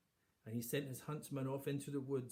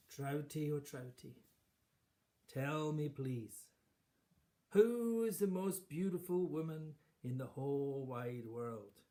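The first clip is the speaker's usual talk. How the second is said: raised, slow, sing-song call down the well; queen calling the fish; 4 semitones higher, 6 dB louder, slower than usual. Acted. Vain queen calling down to the fish, raised and slow. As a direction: call out grandly to the magic fish.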